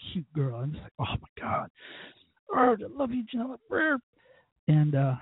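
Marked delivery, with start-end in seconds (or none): 1.0-1.7 awestruck, whispered swoon; voiceless mock swoon. Swooning over her cute voice. 2.5-4.0 high-pitched lovesick squeal; playful falsetto swooning; gushing adoration. Acted. Gushing over Janella's cute voice.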